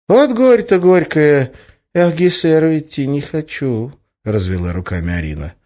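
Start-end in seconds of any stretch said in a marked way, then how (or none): 0.1-3.9 high, drawn-out playful woe; mock lament. A narrator's reading. Witch told to visit the boss, mock lament. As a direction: lament it theatrically, slow and high.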